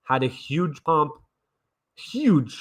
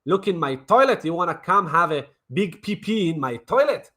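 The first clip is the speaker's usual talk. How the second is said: ordinary talk, nothing marked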